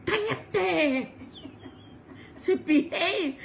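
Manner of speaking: high, lightly put-on voice telling her to hush; a hint of a teasing uncle; playful mock hush, lightly voiced; mock scold.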